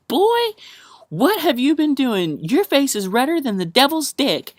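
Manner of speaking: high, loud, shocked Southern aunt. Retold or imitated. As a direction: shriek it like a scandalised aunt catching you stoned. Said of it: "she's like".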